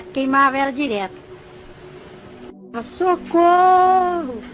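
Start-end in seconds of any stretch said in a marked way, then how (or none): 3.0-4.4 long, high, wailing call for help; imitated desperate cry. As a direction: plead to be rescued, stretching each ending. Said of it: "me gritando pra me socorrer ela". (grito arrastado de socorro, imitado)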